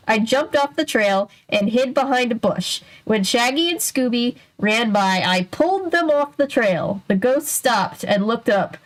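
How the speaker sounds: plain reading voice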